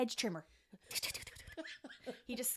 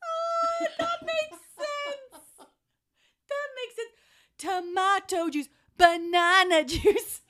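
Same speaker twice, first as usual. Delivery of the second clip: high, slow, laughing realisation; dawning embarrassment through laughter; sheepish realisation; 13 semitones higher, 9 dB louder, much slower than usual. Retold or imitated. Barmaid realising her banana mistake, high laughing. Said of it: "I was like".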